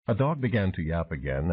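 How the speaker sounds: ordinary delivery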